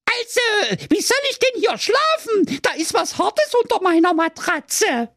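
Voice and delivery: very high complaining princess; very high indignant princess; princess bawling. Acted. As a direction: whine it at full pitch. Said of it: "und brüllte".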